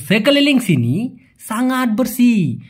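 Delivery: slow demo. Shown as a demonstration. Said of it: "teacher models Malay phrase".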